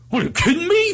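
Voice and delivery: high-pitched incredulous impression; hammed-up high sputter; Steiny. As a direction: squeal it in mock disbelief. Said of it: "we would do Steiny talk".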